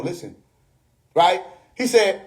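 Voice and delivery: ordinary delivery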